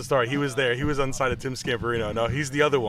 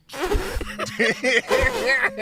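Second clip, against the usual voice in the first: long high laugh. Real, not acted. Salesman laughs remembering the prank. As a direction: dissolve into laughter.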